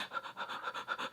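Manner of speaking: quiet mock panic breaths; acted hyperventilating, barely audible; anxious breathing. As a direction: imitate nervous little puffs of breath. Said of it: "little [name] inside me would be like".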